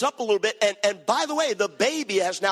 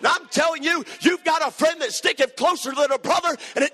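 full-force preaching; 6 semitones higher than usual. Acted. Preacher urging the congregation to rise up and serve God, impassioned preaching.

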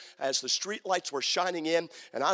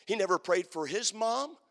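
ordinary talk

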